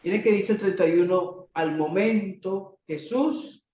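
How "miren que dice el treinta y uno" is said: normal talking voice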